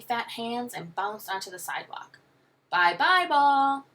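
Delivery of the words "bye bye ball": put-on high, loud toddler wail; loud, high baby call; baby screaming. A narrator's reading. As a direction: scream it like a little child. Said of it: "baby Joshua screamed".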